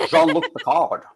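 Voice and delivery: Scottish Picard voice; Sean Connery accent. Retold or imitated. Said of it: "Picard accent is pretty much just Sean Connery".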